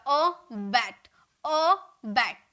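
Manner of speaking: slow "अ बैट", pause after अ, weight on बैट; stress on बैट. Shown as a demonstration. Teacher showing where stress falls, "अ बैट" split with emphasis on बैट. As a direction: draw out अ, then land firmly on बैट. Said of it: "थोड़ा जोर दे रहा है ना".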